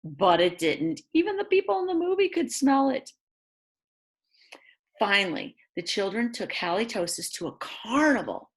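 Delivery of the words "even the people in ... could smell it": quick, high delighted exclaim; high excited. Acted. Reader pointing out the movie crowd, high and quick. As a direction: rattle it off way up high.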